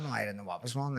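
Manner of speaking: ordinary delivery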